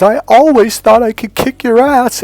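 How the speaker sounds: loud, high mock wail; hammed-up crying outburst; imitated bawling. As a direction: wail it like a tearful girlfriend. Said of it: "she just burst out in tears".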